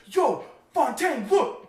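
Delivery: slow, drawn-out call, rising high on look. Retold or imitated. Friend calling across the room to look up at the drone, a drawn-out call. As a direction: yell across the room to get attention.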